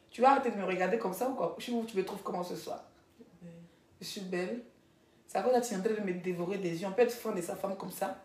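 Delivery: low, soft murmur to a partner; private aside. Real, not acted. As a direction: drop your voice, teasing your sweetheart. Aside to her partner off camera.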